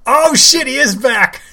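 high, loud delighted exclamation; thrilled by the reveal; excited surprise. Real, not acted. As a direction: react like a fan hearing big news. Reacting to Drago's return.

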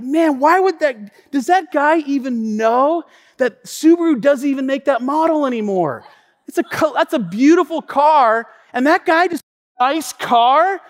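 high-pitched, put-out disbelief; hammed-up offended grumbling; acted indignation. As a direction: rant as if insulted about your car. Offended man stewing over a remark.

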